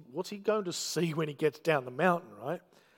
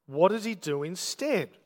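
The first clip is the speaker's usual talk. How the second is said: ordinary talk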